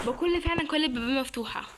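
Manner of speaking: ordinary delivery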